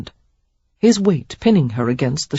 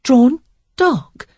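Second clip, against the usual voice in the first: high puzzled echo of drawn dog; lady repeating drawn dog in confusion; confused; 9 semitones higher, 6 dB louder than usual. Acted. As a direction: she doesn't understand the phrase. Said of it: "she repeated in confusion".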